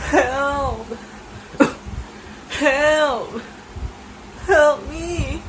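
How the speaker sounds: trapped granny calling out; cries for help. Acted. Grandma calls from the cupboard.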